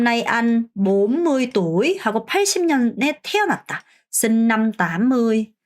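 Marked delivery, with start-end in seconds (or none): 4.1-5.5 slow, clear Vietnamese question; slow model phrase. Shown as a demonstration. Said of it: "이런 식으로 여러분도 얘기하실 수 있습니다". (천천히 또박또박 시범 질문)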